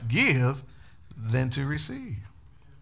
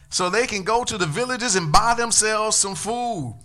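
raised, louder scripture reading to the church; 7 semitones higher, 4 dB louder than usual. Acted. Preacher reads the disciples' words to the congregation, raised. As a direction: read the passage out high and full.

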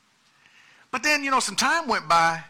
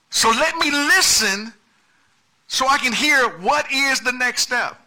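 higher, louder pleading prayer over music; preaching at full force; 6 semitones higher, 4 dB louder than usual. Acted. Preacher voicing a believer praying to God, fervent and loud. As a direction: cry out to God for the next step.

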